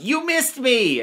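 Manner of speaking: put-on high, gloating kid taunt; taunting toy voice. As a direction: squeal it like a bratty toddler toy. Alien taunting Batman in play.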